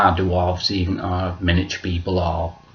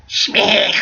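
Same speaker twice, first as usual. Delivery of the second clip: high, odd impression noise; put-on character voice; 12 semitones higher than usual. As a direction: throw out a short high-pitched character sound. Sample voice in a voices session.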